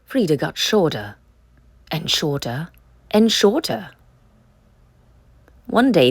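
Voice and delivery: ordinary delivery, nothing marked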